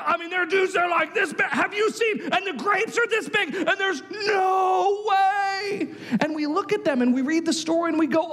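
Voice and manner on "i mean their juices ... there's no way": high-pitched, overwhelmed report; hammed-up dread, last words stretched out; acted alarm. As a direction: panic like a scout who has seen giants. Ten frightened spies report on giants.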